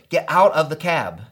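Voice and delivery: ordinary talk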